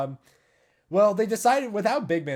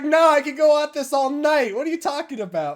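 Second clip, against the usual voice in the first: high, loud comeback; Marina, eager protest; 10 semitones higher, 7 dB louder than usual. Retold or imitated. Imitating singer who could go all night, eager protest. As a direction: brush off the worry, pitched up. Acts Marina refusing to stop.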